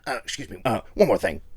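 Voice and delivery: a hint of Columbo tossing in an afterthought; mumbled self-mocking Columbo bit; bad Columbo impression. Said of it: "That's my Columbo. It's terrible".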